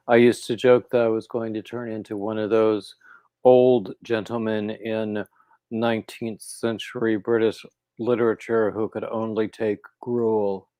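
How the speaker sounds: normal talking voice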